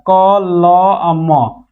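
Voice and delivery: each letter of क ल म said apart, very slowly; spelled out slowly. Shown as a demonstration. Teacher spelling a word for students, very slowly. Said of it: "दिखाई दे रहा है जी आपको".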